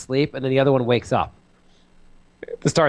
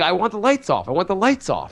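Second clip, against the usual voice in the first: higher-pitched mock toddler demand; imitated demanding child; 6 semitones higher than usual. Son to parents about the lights, insistent child voice.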